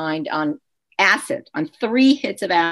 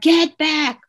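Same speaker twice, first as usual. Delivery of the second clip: higher-pitched barked order, imitated; raised-voice command, short of a shout; retold police yell; 8 semitones higher, 4 dB louder than usual. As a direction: imitate cops ordering someone away. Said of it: "turned and yelled at me".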